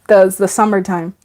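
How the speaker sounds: usual speaking voice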